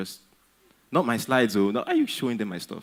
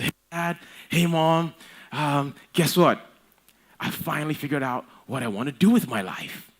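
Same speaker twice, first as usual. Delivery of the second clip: put-on eager kid bursting in, voice pitched higher; excited son; 5 semitones higher than usual. Son bursting into breakfast to tell his parents, eager and high. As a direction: burst in like a giddy teenager with news.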